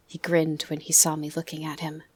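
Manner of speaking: ordinary delivery, nothing marked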